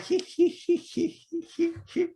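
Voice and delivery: high tittering hee hee hee, imitating dad; cute giggle. Son mimicking his dad laughing at fleeing robbers, high cute giggle. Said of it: "really interesting and kinda cute laugh".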